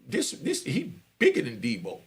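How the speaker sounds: normal talking voice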